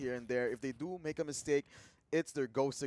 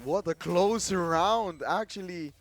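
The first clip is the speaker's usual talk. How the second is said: excited; 5 semitones higher, 14 dB louder, slower than usual. Real, not acted. Reacting to clutch round.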